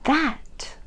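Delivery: loud, high yell. A narrator's reading. Mother yells at the king over the box. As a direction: hurl the word at him. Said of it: "she yelled his mother".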